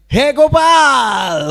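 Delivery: high, loud, long drawn-out call of Hey, Gopal; joyful shout. Retold or imitated. Gopakumar calling out to the Lord in Vaikuntha, loud and drawn out. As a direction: call out across the hall like a cowherd boy. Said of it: "and he's going".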